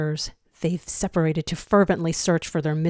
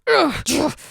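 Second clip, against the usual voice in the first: higher, harsh grunts of frustration; frustrated grunts; 10 semitones higher, 4 dB louder than usual. A narrator's reading. Zero shaking off a flashback, frustrated grunts. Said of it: "he grunts".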